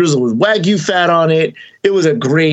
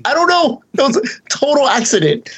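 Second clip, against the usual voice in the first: much higher, playful fake innocence; mock innocent; 11 semitones higher than usual. To Bobby about the lucky spot, high fake innocence. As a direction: shrug it off sweetly.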